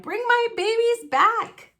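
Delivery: high, loud plea; mother duck crying for her babies. Acted. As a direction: shout after the fireman. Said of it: "she cried".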